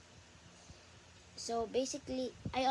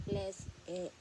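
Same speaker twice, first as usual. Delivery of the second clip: soft, low quacky plea; Donald Duck voice; 4 semitones lower, 4 dB quieter than usual. Pretend. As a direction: beg softly in a duck voice. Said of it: "Donald Duck voice".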